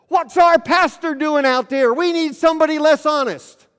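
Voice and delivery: loud, high holler from the bench; yelled heckle. Acted. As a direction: yell it out across a ball field, stretching the words. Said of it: "somebody from the bench yells out".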